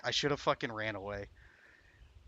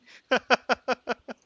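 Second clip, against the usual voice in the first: loud high laugh; 13 semitones higher, 10 dB louder than usual. Real, not acted. Laughing at own death.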